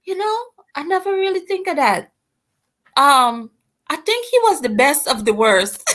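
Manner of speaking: put-on high, quick Guyanese London accent. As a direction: laugh through mom's Guyanese London accent. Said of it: "Say that again in her Guyanese London accent".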